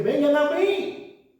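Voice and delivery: high, slow ringing "Vengan a mí"; high announcing invitation; lifted call. Acted. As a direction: lift "Vengan a mí" up high and stretch it. Preacher proclaims Jesus' invitation.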